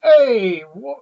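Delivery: playful hammed-up New Yorker; New York tough guy, loud at the start; mock New York accent. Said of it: "Do your New Yorker accent".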